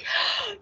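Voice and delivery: sharp high gasp, hardly voiced; dramatic gasp. Hal gasping in horror at his ruined hair. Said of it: "He's like".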